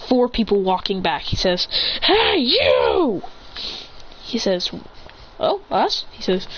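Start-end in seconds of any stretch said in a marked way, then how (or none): 2.0-3.2 high, raised call; acted challenge; confrontational shout. Calling to people walking away. 5.4-6.0 higher-pitched innocent question; small acted surprise; startled reply. Passers-by startled at being called.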